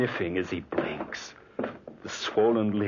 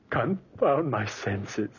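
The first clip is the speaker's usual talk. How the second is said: frustrated outburst; 6 semitones higher, slower than usual. Acted. Frantic outburst.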